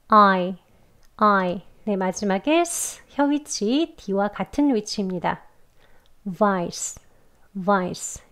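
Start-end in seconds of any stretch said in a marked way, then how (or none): 0.1-1.6 "I" as open "아" gliding to a small "이"; 아에서 이로 가는 "I"; ai diphthong. Shown as a demonstration. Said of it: "입 벌리셔서 아 하고 조금 작게 이예요". 6.4-8.2 "vice" said slowly with a buzzing v and a hissed s; 천천히 "vice"; slow demo. Shown as a demonstration. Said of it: "이렇게 해요".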